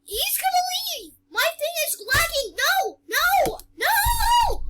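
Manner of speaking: high cries building to a squeal; panicked protest. Real, not acted. Game lags, killer escaping.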